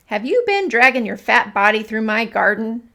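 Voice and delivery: loud, fierce accusation. A narrator's reading. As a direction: demand an answer like a bold mouse. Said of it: "said Ernest fiercely".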